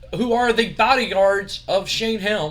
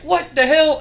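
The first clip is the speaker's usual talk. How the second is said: much higher, louder mock outburst; baffled yell; 10 semitones higher, 7 dB louder than usual. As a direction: blurt it out baffled. Steiner baffled.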